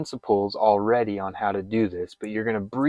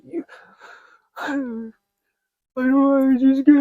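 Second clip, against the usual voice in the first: fake panic; 5 dB louder than usual. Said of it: "I'm giving myself a fake panic attack".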